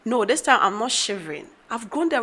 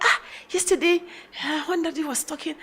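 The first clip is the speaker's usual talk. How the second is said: lightly voiced child, higher; a hint of a child, pitched up; child's voice; 6 semitones higher, 3 dB quieter than usual. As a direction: imitate a hesitant child asking. Mother voices her child's question.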